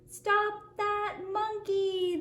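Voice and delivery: sung-out drawn-out yell. A narrator's reading. Racing man yelling after the runaway monkey, slow sung-out yell. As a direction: call it out slow and high, half singing. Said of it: "the man yelled".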